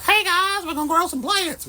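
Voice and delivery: very high, bright-pitched perky greeting; mock the sugary YouTuber hello; chirpy generic plant influencer. Imitating other plant creators greeting viewers, high and perky. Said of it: "I'm talking like that".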